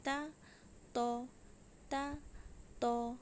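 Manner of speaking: ता and तो said with thin आ then thick ओ, lips not rounded; ता thin, तो heavy and full, lips unrounded; teaching demo. Shown as a demonstration. Teacher demonstrating ता and तो to students, slow and careful. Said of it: "मुंह को गोल नहीं करना है".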